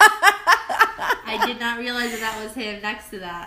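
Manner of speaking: loud, laughing outburst; laughing shout. Real, not acted. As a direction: cry out through laughter. Laughing shocked exclamation.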